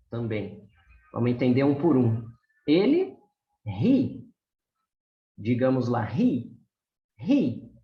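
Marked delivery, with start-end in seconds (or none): 3.7-4.2 the H in ri sounds like a Brazilian R, ri. Shown as a demonstration. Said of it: "a letra H nós lemos como se fosse um R". 6.0-7.7 ri and Ri with the H breathed like a Portuguese R; slow teacher demo of Ri, h breathed out. Shown as a demonstration. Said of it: "a letra H nós lemos como se fosse um R".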